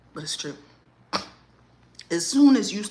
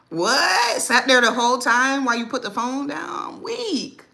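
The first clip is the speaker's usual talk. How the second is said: higher, louder incredulous laughing question; amused disbelief; 7 semitones higher, 7 dB louder than usual. Real, not acted. Mother teasing her son who ignored the call, loud amused disbelief.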